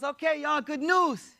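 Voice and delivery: high-pitched rallying call; upbeat retold address; acted announcement. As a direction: announce to the group in a lifted voice. Retold announcement to his followers.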